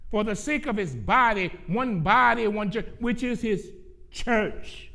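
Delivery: loud, high, emphatic preaching; full-force preaching. Real, not acted. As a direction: drive it home to the congregation, loud and high.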